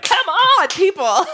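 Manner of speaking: high, loud pleading yell; mock exasperated shout. Imitating Drew urging others to sit out, mock exasperation. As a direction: holler it at a group that won't budge, hammed-up.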